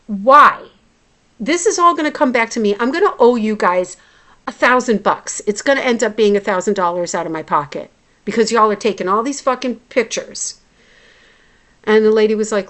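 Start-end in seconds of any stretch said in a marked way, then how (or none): 0.1-10.6 loud, quick retold complaint; exasperated protest. Patient objecting to clinic staff about the bill, exasperated. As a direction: hit the first word hard and rant on. Retold argument over costly scans.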